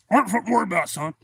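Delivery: loud, high imitation of a stubborn man; retold irritated dismissal. Boat owner brushes off warning.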